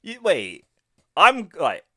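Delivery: sudden high-pitched "wait"; odd high wait. Real, not acted. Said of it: "don't know why I said wait like that".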